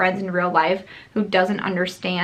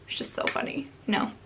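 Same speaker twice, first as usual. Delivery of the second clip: whisper; 3 semitones higher, 9 dB quieter, faster than usual. Real, not acted. Aside while reading her comment.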